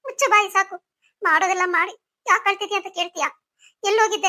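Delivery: loud, upset mother's telling-off; angry scolding. Acted. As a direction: scold him loudly through hurt. Distraught mother scolds returning son.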